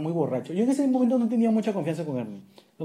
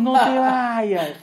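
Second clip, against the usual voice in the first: stretched-out, louder plea, higher; pleading call; 5 semitones higher, 5 dB louder, much slower than usual. Retold or imitated. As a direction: drag it out begging him to stay. Chasing friend down the stairs.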